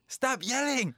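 high-pitched playful yell back; mock marital squabble. Answering a spouse in a pretend fight, yelled. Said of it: "부부싸움 할 때".

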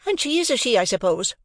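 mock Joe's vagueness; sarcastic scold. A narrator's reading. Sister sarcastically scolding Joe.

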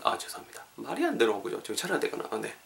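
usual speaking voice

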